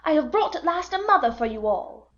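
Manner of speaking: put-on high-pitched boy's proud announcement; Peter. A narrator's reading. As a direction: glad tidings cried in a high boy's voice. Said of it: "he cried".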